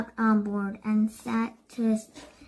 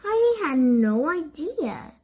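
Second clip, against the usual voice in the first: ordinary delivery, nothing marked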